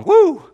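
high, loud woo of joy; excited whoop. Real, not acted. Preacher cheering the devoted few to the congregation, whooping. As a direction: whoop to fire up the room.

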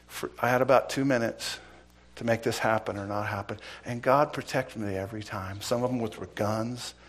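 ordinary talk

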